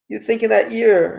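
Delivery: ordinary talk, nothing marked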